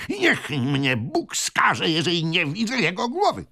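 put-on hot-tempered old man exclaiming; choleric oath. A narrator's reading. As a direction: swear it with excited force. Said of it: "zawołał choleryczny starzec".

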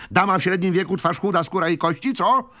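high, loud, urgent query; excited old gentleman; impatient questioning. A narrator's reading. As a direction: fire off the description impatiently.

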